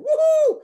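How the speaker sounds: loud, high whoop of delight; cheering whoop. Retold or imitated. Dad whooping as his son crashes his skateboard. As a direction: cheer him on like a fan. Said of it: "every time he gets jacked up".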